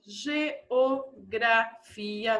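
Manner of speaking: stretched out syllable by syllable; slow dictation. Shown as a demonstration. Teacher giving pupils a long example word, slowly dictated. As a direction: dictate slowly so they can write.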